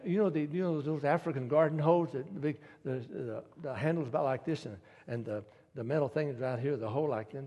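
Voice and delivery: normal talking voice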